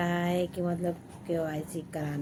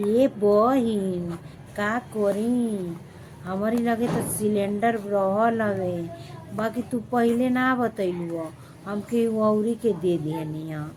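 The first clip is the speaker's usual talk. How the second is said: mimicking fake-sweet neighbour; 2 semitones higher, 4 dB louder than usual. Neighbour woman faking regret about the cylinder, hammed-up sweet voice. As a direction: drag out बहिन with false pity. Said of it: "तो बोलेंगे".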